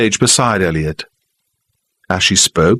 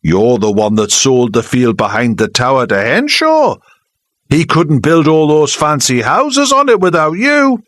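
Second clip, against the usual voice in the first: accusing; 7 semitones higher than usual. Acted. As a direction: blame her before everyone. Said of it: "still playing to the audience".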